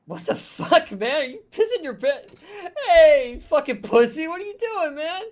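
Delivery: high, loud panicked shouting with a laugh; freaked-out yelling. Acted. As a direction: jeer and yell at him. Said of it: "it's like".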